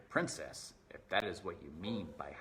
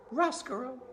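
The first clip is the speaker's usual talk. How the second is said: princess voice; 6 dB louder than usual. Acted. Princess addresses the rat.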